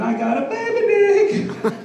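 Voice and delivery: high, slow, laughing confession; laughing squeal. Real, not acted. Comic confessing to the crowd, laughing high squeal. Punchline about himself.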